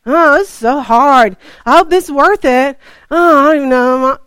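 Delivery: hammed-up self-pitying moan; whiny complaining. Walker grumbling about the long walk to the lake, whining loudly. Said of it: "you got your head down complaining".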